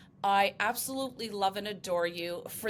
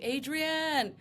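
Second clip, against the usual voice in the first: higher, drawn-out movie call of the name; Rocky; 6 semitones higher than usual. Retold or imitated. Host showing guest how her name sounds, Rocky-style call. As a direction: draw out "Adrienne" like a film quote, not shouted. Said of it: "go back to the Rocky movie".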